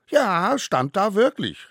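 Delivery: put-on loud, very high little-child voice; calling out. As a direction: squeal out your confirmation. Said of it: "rief wieder der Kleinste". (laut und hoch rufendes Kind)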